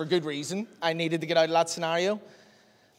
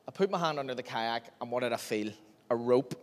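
usual speaking voice

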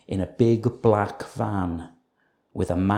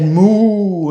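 loud mooing cow, higher; 9 semitones higher, 10 dB louder than usual. A narrator's reading. Cow answering the farm, loud moo. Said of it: "And the cow said".

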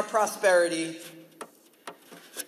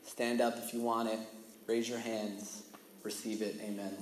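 softer, fading preacher's wrap-up; mock sermon ending; 9 semitones lower, 11 dB quieter than usual. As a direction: wind it down like a typical sermon. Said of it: "where most sermons will end".

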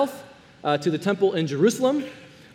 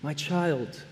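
soft, gentle, much quieter voice; tender Jesus; 4 semitones lower, 7 dB quieter than usual. Acted. Jesus forgiving the ashamed man, soft and tender. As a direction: speak it softly, like a loving father to a child. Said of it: "Jesus looks at this man and says".

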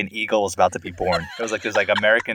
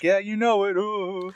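high cheesy voice; 10 semitones higher, slower than usual. Retold or imitated. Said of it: "I'm like".

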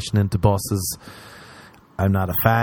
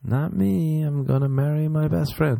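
mock girly protest; 6 semitones higher than usual. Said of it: "She would be like".

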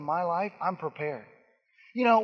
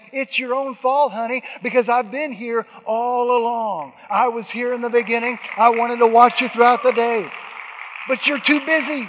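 loud scolding God; 5 semitones higher, 7 dB louder than usual. Acted. God scolding his busy child, louder and higher. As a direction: scold them like a loving father.

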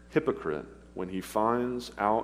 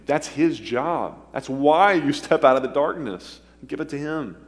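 loud, high, fervent preacher's cry; preaching at full force; 7 semitones higher, 7 dB louder than usual. Acted. Preacher urging the congregation to give their sin to Jesus, loud and high. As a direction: lift it and drive it home to the whole room.

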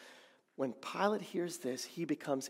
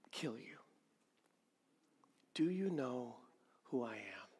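much softer, lower, threatening; menacing hush; 5 semitones lower, 11 dB quieter than usual. Acted. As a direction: drop to a cold threat. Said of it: "But he's asking".

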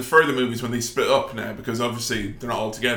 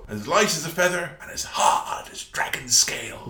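dramatic whisper; 4 dB quieter than usual. Acted. Quoting the line dramatically.

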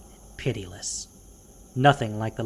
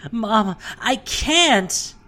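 put-on slow high child's sob, much higher and louder; sobbing child; 9 semitones higher, 4 dB louder, much slower than usual. Boy refuses to leave his mother, sobbing. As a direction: cry it out, begging not to go. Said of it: "Maoli sobbed".

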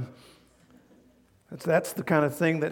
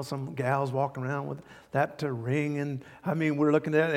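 normal talking voice

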